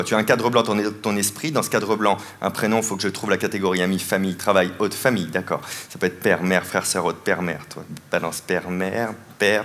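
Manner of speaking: low, even, hypnotic patter; mentalist's suggestion. Acted. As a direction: guide the thoughts in a low monotone. (suggestion grave et monocorde)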